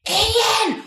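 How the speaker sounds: high loud stage whisper call; whisper yelling. Retold or imitated. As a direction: call out urgently in a hushed yell. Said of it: "whisper yelling, which has never worked".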